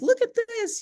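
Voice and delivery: high-pitched excited "look at this"; retold excitement. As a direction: marvel at it, voice up high.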